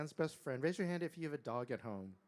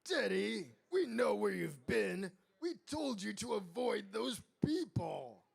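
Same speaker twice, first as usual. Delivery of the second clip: louder, raised parental telling-off; scowling scolding; 6 semitones higher, 6 dB louder than usual. A narrator's reading. King tells off his child, scowling. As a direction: scold with firm emphasis on each phrase. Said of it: "the king scowled".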